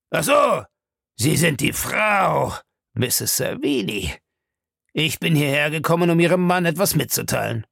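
a hint of a menacing grumbler; gruff villain. A narrator's reading. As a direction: size her up as a surly caller. Narrator voices gruff villain Bellamy.

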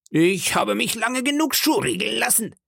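high-pitched indignant complaint; protesting pirate. Acted. Pirate rebels against the leader, high and indignant. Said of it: "rief ein anderer".

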